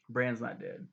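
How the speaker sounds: ordinary delivery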